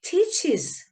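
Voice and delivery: "teaches" as "ti-chis", with a light -is syllable; smooth es ending. Shown as a demonstration. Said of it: "이렇게 발음을 해주면".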